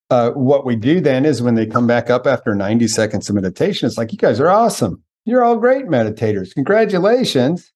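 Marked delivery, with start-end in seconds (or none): 4.1-7.7 high, bright congratulating voice; gushing praise. Acted. Teacher praising meditators after ninety seconds, high gushing congratulations. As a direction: cheer them on with pitch lifted well up.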